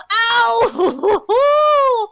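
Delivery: long, high, loud cartoon howl of pain; goofy pained yell. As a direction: holler it out like a cartoon character falling. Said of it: "Just a fucking goofy yell of".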